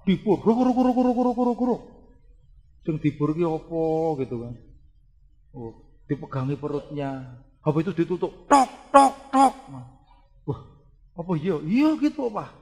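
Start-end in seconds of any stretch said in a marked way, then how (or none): 0.0-1.8 high, louder mock drilling sound; drill noise. Preacher imitates the surgeon's drill during his wife's operation, high and loud. 8.4-9.5 high, loud mock knocking; tapping noise. Preacher imitates the surgeon closing up, high and loud knocking.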